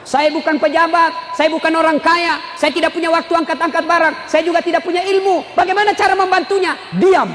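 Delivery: high, insistent declaiming; full-force preaching. Acted. Preacher speaking as one with nothing to give, full-force preaching. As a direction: build the list toward a punchline.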